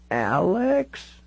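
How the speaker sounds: put-on high, drawn-out elderly voice; old lady slowly trying out a name; very old woman. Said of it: "She looked all of ninety-six years".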